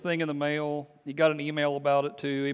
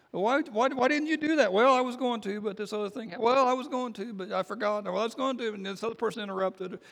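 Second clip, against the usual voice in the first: raised, whiny pitch for the questioner and the excuses; mock excuse voice; 6 semitones higher than usual. A person caught out makes whiny excuses in a high mock voice. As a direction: play both sides of a lame excuse, pitched up.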